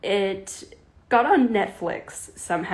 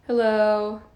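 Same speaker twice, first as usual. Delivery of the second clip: usual speaking voice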